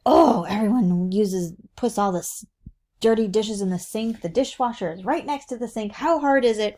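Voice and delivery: imitated irritated griping; crabby. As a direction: complain about a chore nobody does. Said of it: "then I start crabbing about it".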